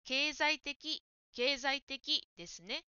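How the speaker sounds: 経済的 said slowly, syllable by syllable; teacher's slow model of 経済的; careful model word. Shown as a demonstration. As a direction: model 経済的 clearly for the learner. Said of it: "経済的の間違いです".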